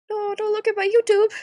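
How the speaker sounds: high flustered plea. Real, not acted. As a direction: beg chat not to look. Repeat louder.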